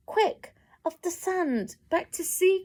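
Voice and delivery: high urgent snail cry. A narrator's reading. Snail crying out to the beached whale, high. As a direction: urge the whale back to sea. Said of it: "cried the snail".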